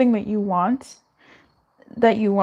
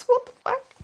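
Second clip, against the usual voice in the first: much higher squeak of disbelief; mock disbelief. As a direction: squeak it out, amused. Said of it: "It was just".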